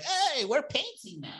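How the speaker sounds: mocking a chirpy, high-pitched TV renovator; cheery TV host. Mocking HGTV couples: high, fake-cheerful chirp. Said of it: "with, like, a smile on their face".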